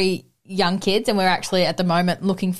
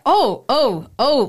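higher, flustered oh oh oh; mock startled hesitation; taken aback; 4 semitones higher, much slower than usual. Rider recalling her startled reaction, higher. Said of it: "I was so, like, taken back".

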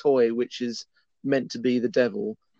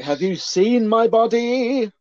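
slow, much higher, preening hero voice; mock vain hero; 9 semitones higher, 4 dB louder, slower than usual. Mocking the shirtless hero to co-host, slow preening voice. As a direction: show off your body like a vain film hero.

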